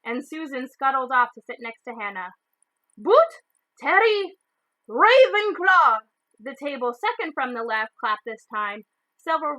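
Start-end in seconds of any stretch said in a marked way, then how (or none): 3.0-4.4 high, loud roll call; calling out a name. A narrator's reading. McGonagall calls the next student to the stool, loudly. 4.9-6.0 higher, loud, drawn-out announcement; hat shouts. A narrator's reading. The hat proclaims the house to the hall, slowly and loudly.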